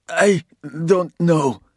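high, slow, pained plea; slow frightened plea. A narrator's reading. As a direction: drag out the denial in fear. Wounded man pleads.

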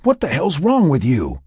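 high, loud alarmed yell. Acted. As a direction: shout it at him in alarm. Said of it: "as he yelled".

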